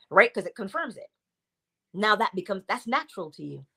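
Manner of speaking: ordinary talk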